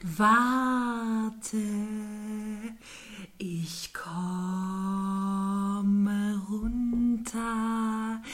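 very slow, whispered snail drawl. Acted. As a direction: breathe it out lazily.

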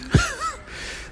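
very high short giggle; laugh. Real, not acted. Amused by banter. (kurzes hohes Kichern)

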